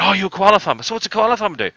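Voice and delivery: high, amazed partygoer gushing over the koala farmer; mock amazed American. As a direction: play a wide-eyed guest who believes it all, high and amazed. Mocks amazed American party guests.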